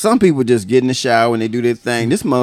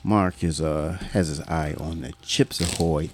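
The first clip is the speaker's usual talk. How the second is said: low, hushed golf commentator; play-by-play in a soft, lowered voice; golf commentary; 8 semitones lower, 8 dB quieter than usual. Pretend. Commentator watching Mark eye the snack pack, hushed golf commentary. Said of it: "like golf".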